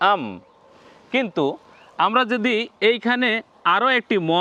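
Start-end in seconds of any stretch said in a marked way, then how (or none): 0.1-0.2 আম with one short ম, no doubling; আম, একবার উচ্চারণ; single consonant. Shown as a demonstration. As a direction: close আম on a single ম. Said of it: "উচ্চারণ হবে এরকম".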